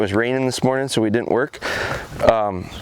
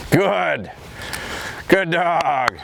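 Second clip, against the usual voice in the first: higher praise; 3 semitones higher, much slower than usual. Real, not acted. Excited praise of dog.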